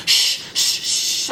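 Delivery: repeated voiceless shush; urgent hushing, acted out; imitated shushing. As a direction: shush like someone hiding. Others shush her in closet.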